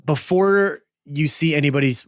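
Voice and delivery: ordinary talk, nothing marked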